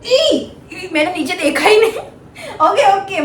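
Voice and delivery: giggling through the words, quick and bright; हँसते हुए राहत से; laughing relief. Real, not acted. Sees she passed, laughs.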